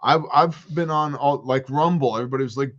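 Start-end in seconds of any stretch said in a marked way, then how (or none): none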